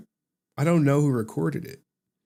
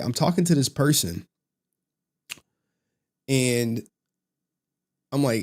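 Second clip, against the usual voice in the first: normal talking voice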